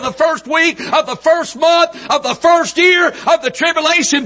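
high, driving revival preaching, building to a shouted peak; full-force preaching. Real, not acted. Preacher exults to congregation over the coming rapture, full-force preaching. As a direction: pile up the phrases, climbing to a shout.

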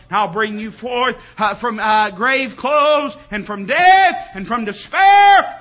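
rising to a loud, drawn-out shout; building proclamation. Acted. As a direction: climactic preaching cry. God's promise preached to a climax.

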